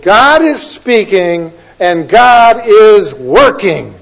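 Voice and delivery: GOD, SPEAKING and WORKING loud, high and drawn out; slow, booming stress on each key word; emphatic preaching. Real, not acted. Preacher tells congregation to remember who is working, slow loud emphasis. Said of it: "the emphasis on the word".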